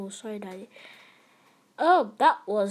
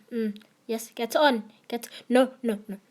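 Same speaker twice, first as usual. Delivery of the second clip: ordinary talk, nothing marked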